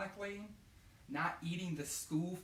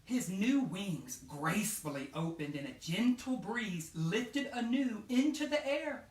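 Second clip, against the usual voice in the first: gentle read-aloud narration, a bit lifted; storybook narration; 4 semitones higher, 5 dB louder than usual. A narrator's reading. Teacher reading a butterfly story to her class, gently. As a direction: read it like a bedtime picture book.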